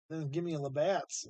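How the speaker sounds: normal talking voice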